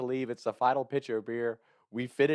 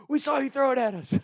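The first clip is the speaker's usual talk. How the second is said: high, loud accusing voice; accusing guys; 5 dB louder than usual. Retold or imitated. The angry guys accuse him, high and loud. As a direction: fling the accusation back at him. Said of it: "And they're like".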